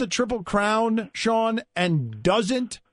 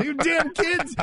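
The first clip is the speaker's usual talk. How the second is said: put-on a touch of a cranky old man; 7 semitones higher, slower than usual. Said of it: "you sound like an old man".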